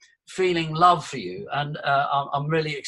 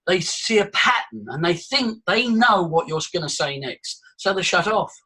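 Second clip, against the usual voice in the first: ordinary talk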